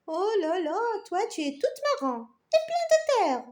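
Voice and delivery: high, haughty little carrot voice; proud. Acted. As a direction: boast it, nose in the air. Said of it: "et dit fièrement".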